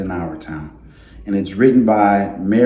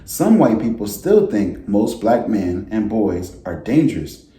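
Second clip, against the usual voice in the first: gentle storybook read-aloud voice; reading to children. A narrator's reading. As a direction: read it like story time for a class. Teacher reads picture book aloud.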